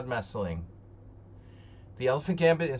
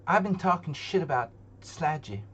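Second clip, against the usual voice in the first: Southern accent attempt; 6 semitones higher than usual. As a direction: try a Southern dad voice. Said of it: "I can't do the King of the Hill, like, the Southern accent".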